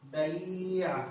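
بيع drawn out very slowly as bayiii-a; original form demonstrated. Shown as a demonstration. Teacher showing the root form of the verb, بيع slowly stretched as bayiii-a. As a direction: hold a long i in بيع, ba-YIII-a. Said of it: "أصلها".

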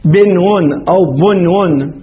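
slow "binwun", then "bunwun" for بنون أو بنون; first بنون with i, second بنون with u, drawn out slowly; both vowellings. Shown as a demonstration. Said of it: "أصلها ماذا". (بنون بكسر الباء ثم بنون بضمها، ببطء)

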